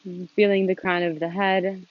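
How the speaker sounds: calm, unhurried instruction with long pauses; gently leading a seated meditation, leaving space between phrases; guided meditation. Real, not acted. Leads a meditation.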